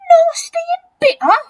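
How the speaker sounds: very high, loud squealed echo; outraged disbelief. A narrator's reading. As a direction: throw it back, theatrically appalled. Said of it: "cried the enormous crocodile".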